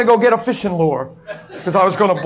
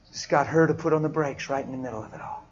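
normal talking voice

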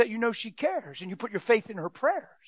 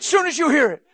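urgent preaching; 6 semitones higher, 6 dB louder than usual. Real, not acted. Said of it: "ignore it completely".

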